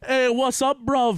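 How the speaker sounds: mock British accent. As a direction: switch suddenly into a cheeky British greeting. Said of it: "I just did British".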